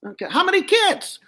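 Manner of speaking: high, brash dummy voice; Joey the dummy. Acted. Dummy flirting with the lady down front, high and brash.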